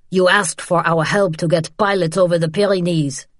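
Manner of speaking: rough man's altered voice, pressing her; accusing interrogator. Acted. Man accuses pinned woman.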